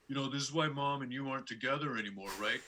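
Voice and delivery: put-on fast, offhand kid jab; lightly voiced son, quick and blunt; imitating his son. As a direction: toss it off quickly like a smug preteen. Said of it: "flawless impersonations".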